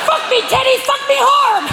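very high squawking parrot cry; parrot mimicking porn. Parrot repeating porn in front of dinner guests, squawking. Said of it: "He's been watching porn again".

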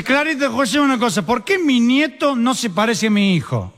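high-pitched, put-on old man; drunk father-in-law. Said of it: "Le dice el suegro".

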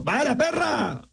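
slow, high mock shout; background shout. Man in the background shouting, slow high mock. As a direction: call it from far back. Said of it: "un tipo por ahí gritó de fondo".

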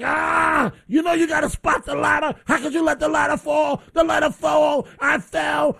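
high, strained retold yell; yelling at son. Dad on his back yelling at his son about the ladder. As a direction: shout it from the floor in pain. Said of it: "I'm yelling at my son".

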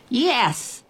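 high, restrained distant shout. A narrator's reading. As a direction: answer from far away. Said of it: "the little girl's shout came again".

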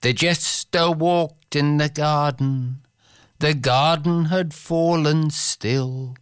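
recited poetry, unhurried and musical; poem recitation. Acted. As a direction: read it like a bard with the Irish refrain. Begins another Yeats poem.

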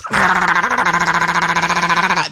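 long, high, steady pump drone; high mechanical whine done by voice; imitated loud pump. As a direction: mimic a noisy old pump with one sustained note. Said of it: "they're so loud, it goes".

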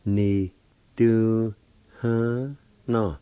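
"ni, du, ha, na" as four separate, held syllables; syllable-by-syllable pronunciation. Shown as a demonstration. Teacher showing learners the second part, "ni, du, ha, na" syllable by syllable. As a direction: sound out "ni, du, ha, na" with gaps between. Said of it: "Second part".

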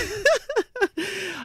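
high squealing laugh. Real, not acted. Host laughing at her own joke on air, high squeal. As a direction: burst into a high-pitched laugh.

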